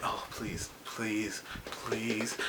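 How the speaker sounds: soft breathless begging; whispered pleading. Retold or imitated. Fan begging the screen, whispered pleading. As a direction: whisper it like a secret prayer.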